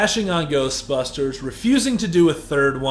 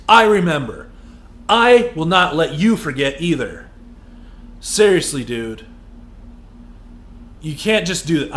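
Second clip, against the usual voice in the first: angry rant. Real, not acted. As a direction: keep hammering him. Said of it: "where did that come from?".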